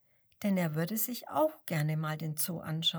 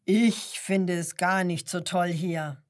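loud grumbling growl; 6 dB louder than usual. A narrator's reading. Lion rejecting the penguin's praise, growling. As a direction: growl it sourly. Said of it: "Der Löwe knurrt".

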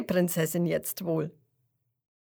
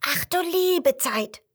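high, slow startled exclamation; startled cry; 9 semitones higher, 4 dB louder, slower than usual. A narrator's reading. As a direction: gasp it out in alarm. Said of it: "ruft er erschrocken".

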